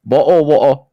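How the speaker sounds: high, very loud British "Bottle water" without T's; mock British accent. Repeating the joke for laughs, loud mock British accent. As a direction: blurt it with glottal stops, exaggerated British.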